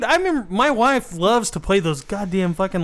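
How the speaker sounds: usual speaking voice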